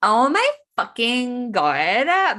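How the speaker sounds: high, loud, slow mocking American accent drawl. Guest mocks the American accent on request, high and loud. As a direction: ham up a grating American accent. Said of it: "demonstrate for us in your most mocking of ways".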